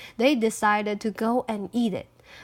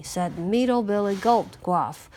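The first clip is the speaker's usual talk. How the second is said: ordinary delivery, nothing marked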